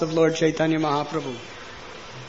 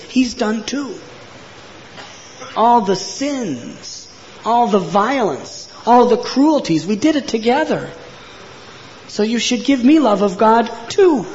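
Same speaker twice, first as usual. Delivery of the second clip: high, insistent begging; desperate pleading; 7 semitones higher than usual. A narrator's reading. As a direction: plead your case, drawing out each appeal. Retold sinner begs for forgiveness.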